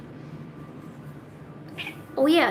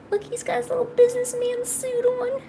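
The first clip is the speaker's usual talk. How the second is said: high, cutesy cooing; 12 semitones higher, 3 dB quieter than usual. Real, not acted. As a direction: coo over an adorably dressed pet. Cooing over dressed-up pet.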